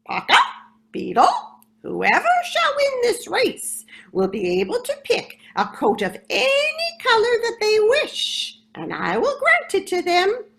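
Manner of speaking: very high, loud squawking parrot. Acted. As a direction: proclaim the rules like a parrot referee. Parrot announcing the rules.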